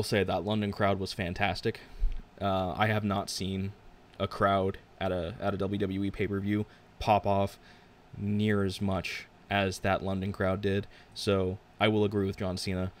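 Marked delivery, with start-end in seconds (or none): none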